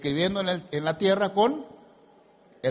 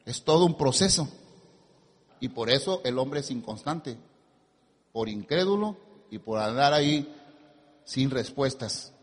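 normal talking voice

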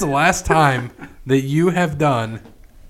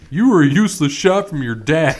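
imitating Anthony; 4 semitones higher, 5 dB louder than usual. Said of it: "him comes back to Brandon".

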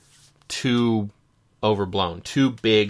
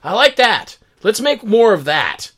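studio exec voice; 5 semitones higher, 6 dB louder than usual. Retold or imitated. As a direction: gush like an eager studio boss. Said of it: "Studio seeing something like".

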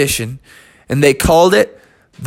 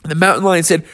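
usual speaking voice

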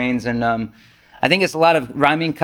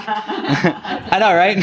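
a laughing, amused admission; 5 semitones higher, much slower than usual. Real, not acted. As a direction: chuckle the words out. Laughs at own irony.

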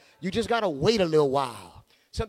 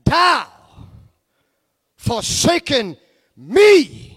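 high, loud anguished cry to God; Jesus crying out; 10 semitones higher, 14 dB louder, much slower than usual. Acted. Jesus on the cross calling to God, loud pleading cry. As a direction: wail each word, stretching forsaken. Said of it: "He said".

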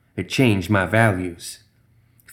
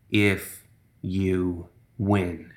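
very slow, softer, word by word; ominous condition; 2 semitones lower, 7 dB quieter, much slower than usual. A narrator's reading. The thing offers terms to the bleeding gambler, slow and ominous. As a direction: draw it out with long menacing pauses.

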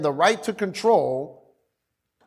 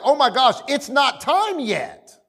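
comic high-pitched dismay; panicked demons; 8 semitones higher than usual. Acted. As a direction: plead for more time in hammed-up alarm. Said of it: "they were like".

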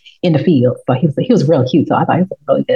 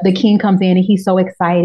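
ordinary talk

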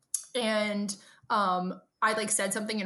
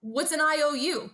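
higher-pitched, lightly voiced clueless dad; retold puzzled question, pitched up; imitating confused dad; 7 semitones higher, 4 dB louder than usual. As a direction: ask it as a mildly baffled dad. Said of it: "he goes".

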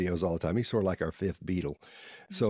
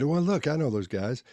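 put-on a light, higher little-kid voice; 6 semitones higher, 3 dB louder than usual. A boy at lunch telling his dad he knows them, lightly kid-voiced. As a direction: play yourself as a small boy, a bit higher. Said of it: "however you say it as a kid".

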